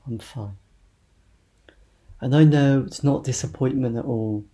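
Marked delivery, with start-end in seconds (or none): 0.1-0.6 soft, low, dropped away; subdued cover-up. Acted. Friend hiding how they feel, subdued and quiet. Quotes the answer that hides feelings.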